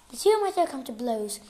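ordinary delivery

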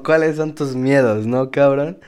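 high, slow put-on reporter voice; mock interviewer. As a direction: play a hammed-up TV reporter. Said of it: "la reportera, lo que sea, me preguntaba".

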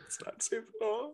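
sudden squeaky high voice; high squeak. Acted.

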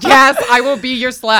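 loud, high laughing yes; laughing repeat. Real, not acted.

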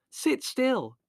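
very high screeched command; panicky, high-pitched order. A narrator's reading. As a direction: screech it in alarm, without much volume. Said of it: "screeched Margot".

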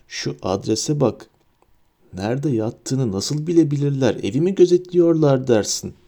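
usual speaking voice

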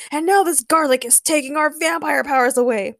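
mildly hammed-up exasperation; yelled complaint. A narrator's reading. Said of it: "yelled Louis".

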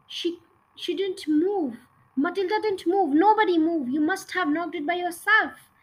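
cried out protest. A narrator's reading. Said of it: "they cried out loud".

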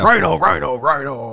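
monster truck ad. Retold or imitated. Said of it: "It's like the monster truck guy".

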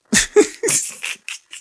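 breathless high-pitched giggle; high wheezing laugh. Real, not acted. Cracking up that he can't read, high wheezy laugh. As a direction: break into a squeaky laugh.

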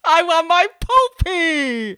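high, loud, hammed-up wailing; crying tantrum. Crying pregnant woman demanding her old boots back, mock tantrum. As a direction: wail like a child denied, stretching the last words. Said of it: "And I'm crying".

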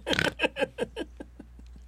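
snorting laugh. Real, not acted.

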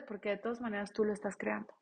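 ordinary delivery

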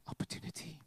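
a pointed, breathy one-word whisper; wife whispering a knowing hint to her husband. Retold or imitated. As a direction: whisper it like a shared secret. Wife hints meaningfully at him.

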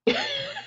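a short high burst of laughter; sudden high giggle. Real, not acted. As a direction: laugh it off in surprise. Laughing at slavery remark.

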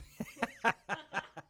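high burst of laughter; helpless high giggling; laughing. Real, not acted. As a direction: crack up laughing at your own words. Cracks up at tank station slip.

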